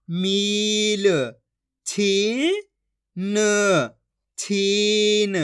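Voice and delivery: each syllable stretched long and held; very slow syllable-by-syllable reading demo; drawn-out pronunciation. Shown as a demonstration. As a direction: draw out every vowel like a reading teacher. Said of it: "आपको खींच के पढ़ना है".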